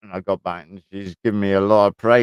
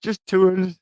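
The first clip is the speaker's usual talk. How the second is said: laughing; 11 semitones higher, 5 dB louder than usual. Retold or imitated. Retelling his sheepish answer, laughing.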